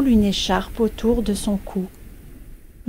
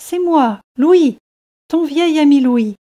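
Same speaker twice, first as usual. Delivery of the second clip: higher, louder joyful reunion; excited reveal; 5 semitones higher, 5 dB louder than usual. A narrator's reading. Louis reveals himself to his old friend George, excited. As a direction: announce yourself eagerly to a long-lost friend.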